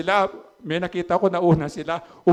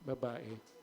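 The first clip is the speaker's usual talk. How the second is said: Babae repeated much softer, almost to himself; softer echo; 5 semitones lower, 18 dB quieter than usual. Shown as a demonstration. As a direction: let the second Babae drop away to near silence. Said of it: "anong tawag niya sa nanay niya?". (mahinang ulit ng salita)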